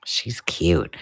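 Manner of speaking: hushed and fond; tender whisper. Real, not acted. Whispering over a baby picture.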